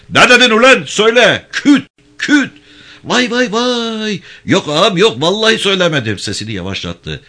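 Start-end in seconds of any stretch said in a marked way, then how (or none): 0.1-2.5 much higher, louder staged bellow; mock interrogation shout. Gendarme faking a harsh interrogation for listeners outside. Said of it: "bağırdı". 3.0-6.2 much higher, louder mock cries of pain; fake wailing. Prisoner faking cries so the guards think he's beaten. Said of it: "Bağır".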